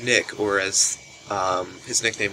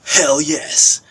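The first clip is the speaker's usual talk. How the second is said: loud, high, delighted shout; three friends yelling yes to a hot tub; eager yell; 6 semitones higher, 9 dB louder than usual. Retold or imitated. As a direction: cheer it like the best offer ever. Friends answering a hot tub invite.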